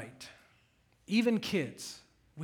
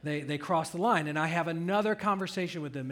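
ordinary talk, nothing marked

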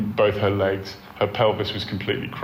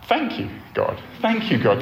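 delighted thanks; 11 semitones higher than usual. Retold or imitated. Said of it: "And I'm like".

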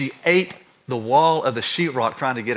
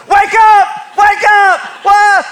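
high, loud desperate yelling; shouting; 10 dB louder, much slower than usual. Retold or imitated. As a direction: yell to shake her awake. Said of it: "now I'm screaming at her".

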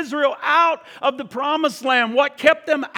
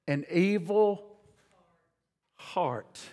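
hushed scripture; 5 semitones lower, 8 dB quieter, much slower than usual. Acted. Dramatic reading of scripture.

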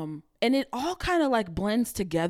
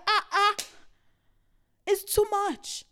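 exasperated; 11 semitones higher than usual. Real, not acted. Fed up with social media.

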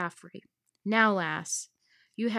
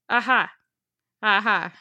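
loud, maniacal "Aha!" like a mad pirate; mad laugh; 8 dB louder than usual. Acted. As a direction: bark out a crazed villain's laugh. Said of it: "laughing like a madman".

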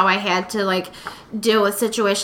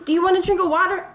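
high, gentle-mannered offer; mock-sweet suggestion, pitched up; careful coaxing; 8 semitones higher than usual. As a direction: coax a touchy friend in a high voice. Acting out coaxing upset friend.

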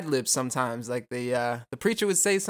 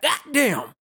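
high, loud burst of goddamn; exclaimed goddamn; 8 semitones higher, 4 dB louder than usual. Shown as a demonstration. Host shows how he loves to exclaim it, high and loud. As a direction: blurt it out with relish. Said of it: "I just love to be like".

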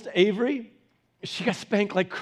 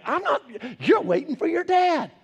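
mock exasperated mom; 8 semitones higher than usual. Mom scolding child.

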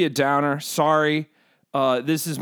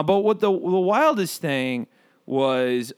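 ordinary talk

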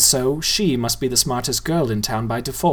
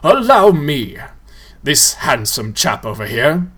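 louder, showy ghoul host; grand ghoul; 4 dB louder than usual. A narrator's reading. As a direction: present them like a showman. Tall ghoul introduces.

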